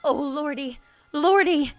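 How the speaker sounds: frightened cry. Acted. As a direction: whimper out in terror. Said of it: "shivered and shook and cried".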